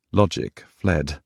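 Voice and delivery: ordinary delivery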